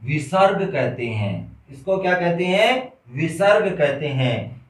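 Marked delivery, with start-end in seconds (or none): none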